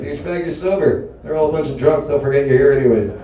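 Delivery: usual speaking voice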